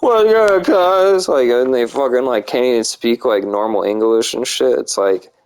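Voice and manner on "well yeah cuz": loud, higher mock of wannabe street slang; mocking slang imitation. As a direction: parody the slangy kids, pitched up and loud.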